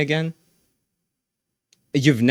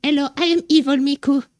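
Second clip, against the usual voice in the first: very high-pitched put-on character voice; evil Miku; 13 semitones higher than usual. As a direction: play a squeaky little villain introducing herself. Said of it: "She's like".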